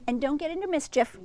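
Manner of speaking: 1 mother rabbit. Acted. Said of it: "said old Missus Rabbit".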